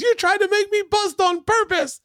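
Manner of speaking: imitated high-pitched angry accusation; furious Bobby. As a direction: yell the accusation in hammed-up outrage. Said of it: "fucking furious".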